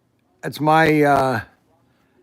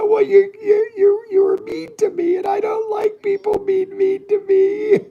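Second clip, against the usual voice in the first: much higher, hammed-up sobbing whine; mock crying. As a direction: wail the complaint like a fake crybaby. Fake crying complaint.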